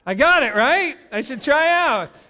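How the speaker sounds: hammed-up self-congratulation; mock triumph. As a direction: proudly claim you've mastered it. Joking he mastered nascar.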